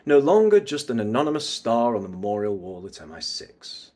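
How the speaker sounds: restrained villain's monologue delivery; understated bad guy; mysterious bad guy. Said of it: "bad guy mysterious vibes".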